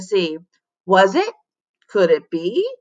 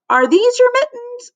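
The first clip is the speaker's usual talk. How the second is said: a much higher kindly voice for Mister Jupiter; high gentle janitor asking a child; teacher voice; 3 dB louder than usual. Acted. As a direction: lift the voice way up into the character. Teacher voice asks girl.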